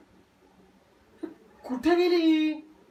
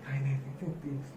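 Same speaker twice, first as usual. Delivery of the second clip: muttering to himself; 11 semitones lower, 14 dB quieter, faster than usual. Acted.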